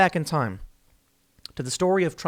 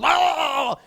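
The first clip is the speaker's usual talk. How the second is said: high, wailing mock outrage; hammed-up indignant moan; Joe Biden; 9 semitones higher, slower than usual. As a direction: splutter it slowly as if mortally offended. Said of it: "barfs all over him. He's like".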